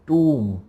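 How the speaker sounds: टूम with a long oo, ending on m; टूम said with silent b; correct pronunciation. Shown as a demonstration. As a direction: draw out टूम, no b sound. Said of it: "इसको हम बोलेंगे टूम".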